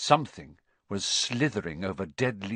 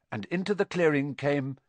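ordinary delivery

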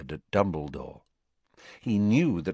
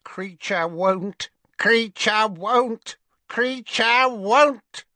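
high, slow croaking elf voice, louder each time; croaking house elf; 14 semitones higher, 4 dB louder, much slower than usual. A narrator's reading. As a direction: croak it out like a stubborn little elf. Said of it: "croaked the house elf, quite as loudly as Uncle Vernon".

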